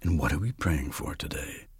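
low, hushed Death; a little below narration. Shown as a demonstration. Actor voicing Death to the lady, low and soft. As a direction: sink softly below the narrator. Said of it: "a little below my narrative voice".

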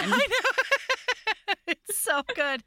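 real giggle while gushing about the book; laughing. Real, not acted. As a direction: laugh it out with glee.

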